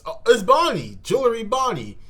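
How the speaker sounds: light up as she appears; excited squeal at the reveal. Real, not acted.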